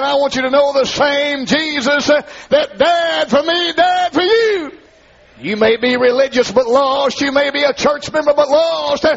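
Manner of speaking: high chanted preaching, near a shout; sing-shout the gospel line at full force; preaching whoop. Real, not acted. Altar call in chanted whoop.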